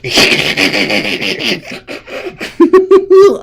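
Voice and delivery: long high put-on giggle; hammed-up wheezy tittering that climbs high; mock gleeful laughter. Russia and China gloating, fake gleeful laughing. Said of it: "Russia and China was, are like".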